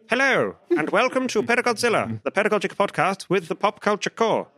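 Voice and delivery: high, bright-sounding radio intro, hammed up; announcer voice. Host opening the podcast like a radio announcer. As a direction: open the show like a big radio DJ.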